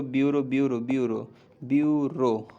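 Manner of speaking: a hint of a student's mistaken "bureau", repeated; lightly mock the wrong "bureau"; mimicking the wrong spelling. Shown as a demonstration. Teacher mimicking how students wrongly write it. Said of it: "ऐसे लिखता है आदमी".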